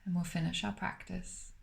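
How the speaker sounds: calm, unhurried meditation voice with long pauses between phrases; guided meditation. Acted. Meditation teacher guiding listeners to smile through the body, calm and spacious.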